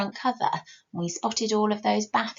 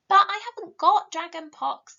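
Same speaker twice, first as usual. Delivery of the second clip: indignant protest; 7 semitones higher, 7 dB louder than usual. Acted. Ellen objects to Mirabell.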